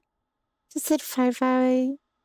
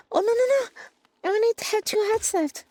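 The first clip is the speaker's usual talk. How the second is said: panicked; 7 semitones higher, faster than usual. Real, not acted. Losing health.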